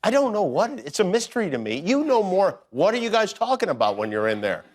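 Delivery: ordinary talk, nothing marked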